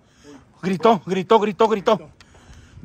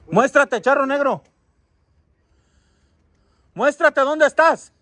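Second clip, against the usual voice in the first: raised voice demanding it appear; calling out; 7 semitones higher, 3 dB louder, slower than usual. Real, not acted. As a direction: summon a spirit, last words drawn out. Calling out a spirit. (llamando en voz alta, alargando las palabras)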